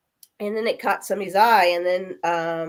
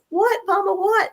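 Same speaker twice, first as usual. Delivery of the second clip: much higher, pleading daughter's voice; imitating anxious daughter; 13 semitones higher than usual. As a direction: daughter leaning in at the deathbed, asking. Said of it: "And she's like".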